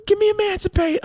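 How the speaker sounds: very high-pitched begging whine; desperate pleading. Acted. Son begging dad to let him go, pleading. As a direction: plead as if cornered. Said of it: "I was trying to plead".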